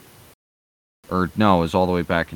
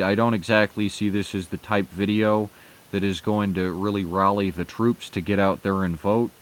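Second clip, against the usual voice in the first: normal talking voice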